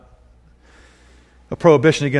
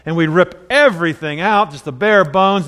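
usual speaking voice

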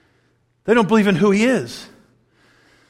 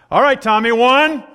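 louder, firm countdown; parent counting; 6 semitones higher, 7 dB louder than usual. Retold or imitated. Parent counting down at a child who won't move, louder and firm.

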